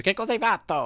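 imitating a friend's high-pitched scolding yell; mock the outraged yell you expect from him; urlo imitato. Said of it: "mi urla e mi dice". (imitazione acuta di una sgridata urlata)

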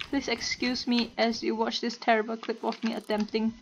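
normal talking voice

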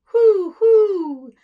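very high, loud hammed-up crow with a laugh; rooster crow. Teacher doing vocal exploration as the rooster. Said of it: "great vocal exploration".

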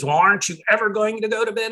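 high, fast imitation of a scolding father; nagging father. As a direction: mimic an exasperated dad hurrying his kid to bed. Father scolding, hovering over sleeper.

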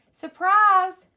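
high, loud surprise shout; excited shout. A narrator's reading. McWhiskers surprising P J with a candle, shouted. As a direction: shout it out, drawn long and high. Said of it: "Kawali shouted".

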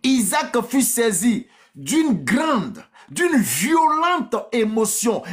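drawn-out, high, solemn proclamation; dramatic scripture reading. A narrator's reading. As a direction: stretch the weighty words slowly and dramatically.

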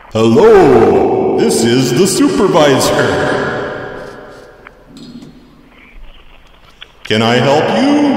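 slow, drawn-out put-on supervisor voice. Fake supervisor takes over the prank call, slow put-on voice. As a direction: stretch each phrase out as a silly supervisor. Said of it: "Let me get the supervisor".

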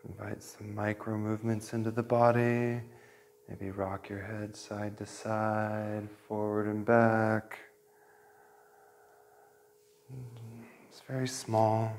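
soft, hushed meditation voice, whispered at the start; guided meditation. Acted. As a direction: gently guide the class into their breath.

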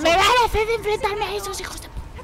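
panicked outburst. Real, not acted.

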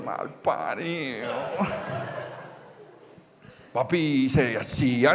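low, slow, hushed curse; muttered curse. Acted. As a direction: drop it to a slow, soft grumble. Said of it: "están mirando y diciendo".

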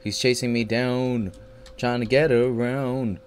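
usual speaking voice

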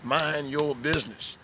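ordinary talk

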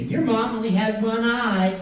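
high sing-song schoolyard taunt; teasing. Acted. Classmates teasing the one-eyed woman's child, sing-song taunt. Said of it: "everybody started teasing me".

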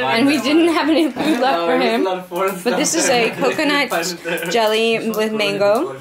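host calling out the dessert to the room; loud presenting. Real, not acted. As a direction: present the dessert over the noise.

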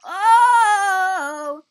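sudden loud startled shout; startled yelp. Real, not acted. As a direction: jump at the screen. Startled gaming outburst.

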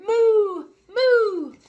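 high, loud mock moo; a cartoon cow's confused moo; muttering cow. The confused cow mooing, high and hammed-up. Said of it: "who muttered".

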